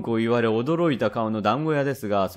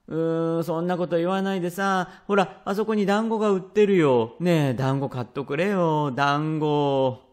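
put-on high-pitched little boy pestering dad; pleading child voice; wheedling child; 4 semitones higher than usual. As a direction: whine and coax like a child begging for a treat. Child begging father for dumplings.

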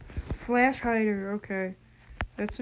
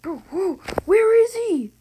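startled shout; 9 semitones higher, 11 dB louder than usual. Real, not acted. Startled by enemy in game.

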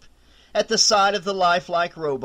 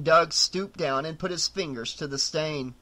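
ordinary delivery, nothing marked

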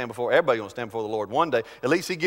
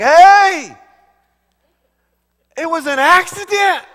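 high, loud, stretched-out yelp; mock indignant protest; 12 dB louder, slower than usual. Preacher acts out the accidental elbower knocked out, indignant yell. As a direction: cry out as if wrongly punished.

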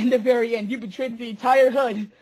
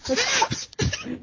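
high giggle; 3 dB quieter than usual. Real, not acted. Laughing at own line.

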